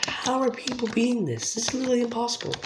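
high-pitched incredulous complaint; exasperated disbelief. Real, not acted. Gamer baffled that people beat this, exasperated disbelief.

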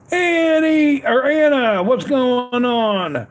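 high, slow sing-song call of her name; drawn-out greeting. Real, not acted. Greeting Anna in the live chat, high drawn-out call.

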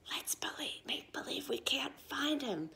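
put-on whispered plotting to the other kids; a sneaky whisper between friends; conspiratorial whisper. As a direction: hush it so he can't hear. Kids plot to pretend-search.

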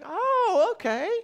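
high, loud mock realization; hammed-up relieved "oh"; dawning relief. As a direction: let it swoop high, then settle. Acts out his sudden relieved understanding.

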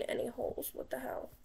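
puzzled whisper. Real, not acted. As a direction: breathe it out under your breath.

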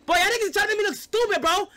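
fired-up indignation at chat; fast riled-up rant; exasperated fast outburst. Real, not acted. Laughing outrage at chat.

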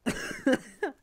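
laughing until choking; choking on laughter. Real, not acted. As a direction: gasp and choke through the laugh. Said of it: "sorry I'm choking".

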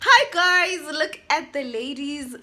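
high, loud excited hello to the guys; excited greeting. Real, not acted. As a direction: call out to your friends, thrilled. Calling friends to see ladies.

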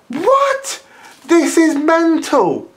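shrieking high, slow outrage; angry rant. Real, not acted. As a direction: explode in disbelief. Ranting at the videos.

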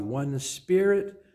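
normal talking voice

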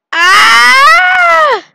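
loud high startled scream. Real, not acted. Player screams as he is nearly eaten, loud high scream. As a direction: yelp in sudden panic.

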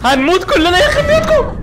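loud, high desperate yell; panicked shout. Real, not acted. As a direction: scream that everyone's doomed. Panicked shout as all fall. (صرخة ذعر)

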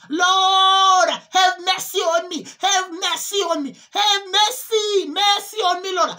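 high, loud, desperate crying out to the Lord; loud anguished wailing, pleading not to be punished; wailing plea for mercy. Retold or imitated. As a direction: beg for mercy at the top of your voice. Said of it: "they would cry and they would say".

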